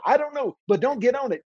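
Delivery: put-on high, frightened child voice; a child too spooked to explain; scared little boy. Little boy under the covers answering his brother, high and scared. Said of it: "I said".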